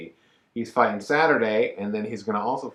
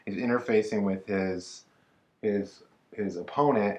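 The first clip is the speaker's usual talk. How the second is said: ordinary delivery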